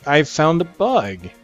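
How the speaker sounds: ordinary talk, nothing marked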